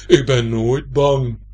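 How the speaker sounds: slow, booming big voice; loud, drawn-out heavy voice. A narrator's reading. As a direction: boom it out slowly and weightily. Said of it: "klinkt plotseling een zware stem".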